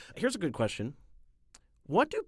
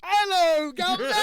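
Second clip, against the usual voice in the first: high, hammed-up Cockney greeting; mock British accent; 8 dB louder than usual. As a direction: chirp it like a cartoon Londoner. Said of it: "talk like that the rest of the episode".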